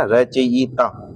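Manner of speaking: रचयिता very slowly as "ra-cha-yi-ta"; slow, drawn-out रचयिता for dictation; syllable by syllable. Shown as a demonstration. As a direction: spell out each sound of रचयिता. Teacher breaks word into syllables.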